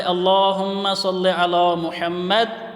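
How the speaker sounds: slow recitation stopping fully on Muhammad; a slow recited phrase ending in a clear stop on Muhammad; pause after Muhammad. Shown as a demonstration. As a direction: recite slowly, then halt on Muhammad. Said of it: "محمد پہ وقف کیا".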